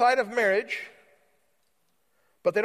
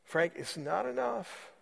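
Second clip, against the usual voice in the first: hushed, intimate whisper; 6 semitones lower, 9 dB quieter than usual. Acted. Jesus leaning in to a preacher's ear, hushed. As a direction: gently confide it, dropping away to almost nothing. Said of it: "he'd whisper in your ear".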